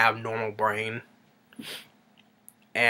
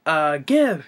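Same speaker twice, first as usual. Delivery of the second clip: charades hints; 5 semitones higher, 4 dB louder, much slower than usual. Retold or imitated. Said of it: "three syllables. Sounds like".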